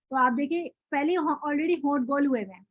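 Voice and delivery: ordinary delivery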